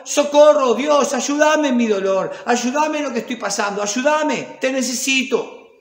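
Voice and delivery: loud, high pleading shout, easing off; desperate cry for help. Acted. A sufferer crying out to God for help, desperate loud plea. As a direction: cry out to God like someone drowning.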